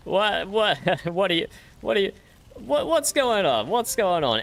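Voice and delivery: high, squeaky laughing "what"; laughing disbelief. Real, not acted. Shocked laughter at the creature.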